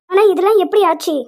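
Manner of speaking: loud, alarmed demand; loud alarmed question. Acted. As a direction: push for an answer, rattled. Alarmed at the news.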